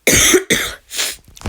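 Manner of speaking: a rough cough from a sore throat; real cough. Real, not acted. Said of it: "J'ai un peu mal à la gorge". (toux rauque, vraie)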